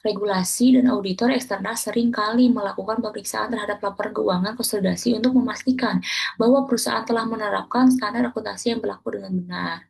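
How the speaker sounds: ordinary talk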